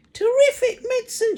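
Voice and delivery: put-on very high, loud cackling old woman; screechy crone. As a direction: cry out like a greedy hag. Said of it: "she cried".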